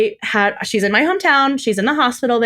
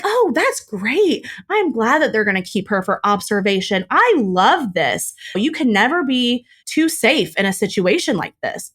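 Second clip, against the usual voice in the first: laying it on thick. Retold or imitated. Playing along with her sister-in-law's story, laying on fake support. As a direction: gush in mock support, stretching the key words. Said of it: "Again, laying it on thick".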